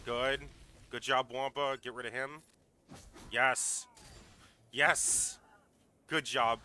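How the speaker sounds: loud thrilled yes; excited cheering. Real, not acted. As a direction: cheer a win loudly. Creature wins, he cheers.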